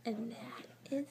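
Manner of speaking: breathy hushed whisper. Shown as a demonstration. Reading to a child, ending in a whisper. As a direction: drop to a whisper to end. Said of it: "a whisper".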